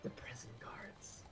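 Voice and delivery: menacing whisper. Acted. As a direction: drop to a hushed threat. Guard's line ends as whisper.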